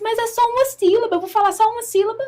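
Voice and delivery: high-pitched, doubtful objection; retold mild protest. Woman objects to friend's instruction, retold incredulous protest. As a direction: push back in disbelief, lightly acted.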